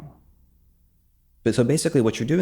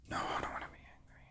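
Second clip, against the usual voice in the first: sighing whisper of what you want; soft sighing whisper to yourself; whispered inner voice; 18 dB quieter, faster than usual. Shown as a demonstration. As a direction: breathe it out, barely voiced. Demo of resistance voice, whispered.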